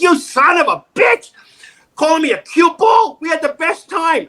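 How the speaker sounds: high, furious mock shout; angry outburst. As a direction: yell it out, outraged at the insult. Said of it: "I went".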